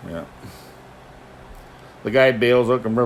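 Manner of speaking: usual speaking voice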